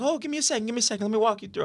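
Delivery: high-pitched, chirpy imitation of her; imitated hostess. Retelling her offer to walk him through, high imitated voice. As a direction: raise your pitch to mimic her hurrying over. Voicing the woman at the class.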